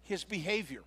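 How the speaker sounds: soft, hushed echo of His behavior with a falling tune; quiet repeat. Shown as a demonstration. Repeats the phrase, then again.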